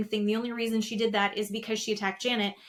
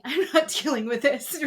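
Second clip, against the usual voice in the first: laughing through quote; 3 semitones higher, 4 dB louder than usual. Retold or imitated. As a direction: quote broken by laughter, louder. Laughing while quoting Kristen.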